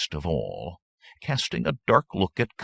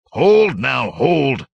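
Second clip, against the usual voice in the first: loud, high order to halt; commanding shout; 9 semitones higher, 12 dB louder, much slower than usual. A narrator's reading. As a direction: halt the angry crowd. Hordo steps in to stop bandits.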